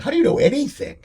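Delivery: high-pitched baffled question; mock disbelief, pitch jumping way up; incredulous. Acted incredulous question to players.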